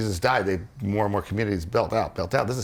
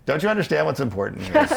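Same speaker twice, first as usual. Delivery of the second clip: exasperated Jesus; 8 semitones higher, 4 dB louder than usual. Retold or imitated. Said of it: "he'd be like".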